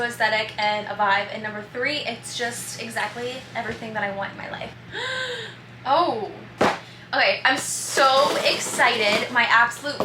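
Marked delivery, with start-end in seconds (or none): 4.8-5.5 gasp. Real, not acted. Unboxing, sudden surprise.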